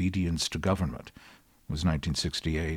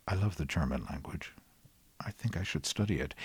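whispered aside; 6 dB quieter than usual. Real, not acted. Whispered aside about loving German.

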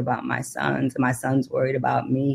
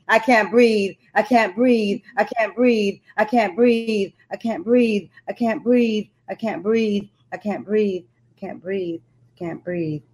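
refrain fading to nothing; 5 semitones higher, slower than usual. Acted. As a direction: let each repeat lose breath and die away. Poem's closing refrain fading out.

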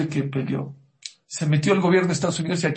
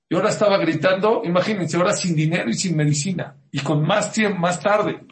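loud, raised, fast-building urgency; pressing, high-energy narration; urgent storytelling; 5 semitones higher, 5 dB louder than usual. Real, not acted. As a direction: pile up the mounting trouble. Storyteller builds up mother's desperation.

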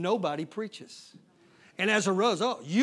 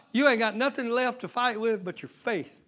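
normal talking voice

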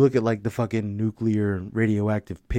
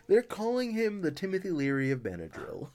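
laughing while talking; 9 semitones higher, 6 dB quieter than usual. Real, not acted. Repeating friend's joke laughing.

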